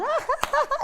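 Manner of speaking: a loud, high cackle of a prankster; high mocking laugh. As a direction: laugh like a boy who fooled everyone. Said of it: "And Lolu laughed".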